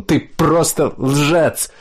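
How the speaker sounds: slow, high-pitched accusing cry; acted cry. A narrator's reading. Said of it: "закричал он".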